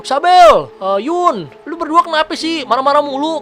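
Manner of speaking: high, loud call that turns scolding; father calling out. Retold or imitated. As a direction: call them like an exasperated dad. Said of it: "Panggil kan tuh". (ayah memanggil sambil mengomel, nada tinggi)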